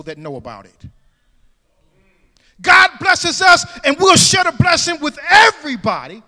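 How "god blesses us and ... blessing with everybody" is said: loud, high preaching cry; full-force preaching. Acted. Pastor exhorting his church, full-force preaching. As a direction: preach it out to the congregation.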